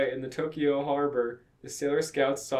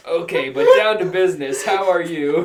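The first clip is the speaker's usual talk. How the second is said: loud, higher line breaking into laughter; laughing through it; 4 semitones higher, 10 dB louder than usual. Acted. Snake turning to business, voiced through laughter. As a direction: belt it out while cracking up.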